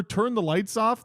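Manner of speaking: ordinary delivery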